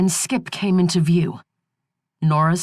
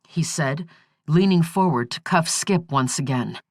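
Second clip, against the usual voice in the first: ordinary delivery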